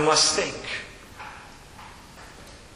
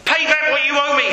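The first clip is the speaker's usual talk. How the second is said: firm demand, pitched up; 6 semitones higher than usual. A narrator's reading. Servant to his debtor, demanding. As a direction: demand it forcefully at a raised pitch. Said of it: "he demanded".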